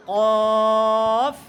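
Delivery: long, loud, rounded "কফ" closing on f; full, level model "কফ"; heavy qaf. Shown as a demonstration. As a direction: hold a thick back-of-mouth "কফ", teeth to lip at the end. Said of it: "লাস্টে দিয়ে উপরের দাঁত নিচে ঠোঁটের সাথে".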